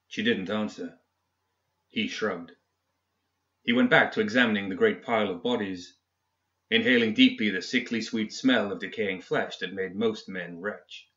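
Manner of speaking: ordinary delivery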